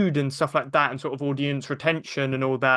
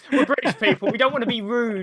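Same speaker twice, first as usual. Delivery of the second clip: exaggerated posh British accent, pitched up; hammed-up British voice; 7 semitones higher than usual. British guest hams up polite Brits to the host, in a mock British accent. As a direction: play the overly polite Brit, raising your pitch. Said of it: "I had to act up a British voice".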